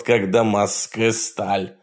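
plain reading voice

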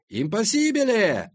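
high, loud, drawn-out exclamation; shouted reply. A narrator's reading. Semyon yells back from the waves, high drawn-out shout. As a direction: holler it back, stretching the word out high. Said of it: "крикнул".

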